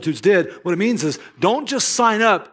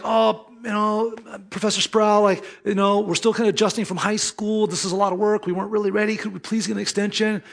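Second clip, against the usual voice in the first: higher, pleading student voice; whiny pleading students; 6 semitones higher than usual. Retold or imitated. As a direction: beg for more time like a nervous freshman. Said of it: "And it was like".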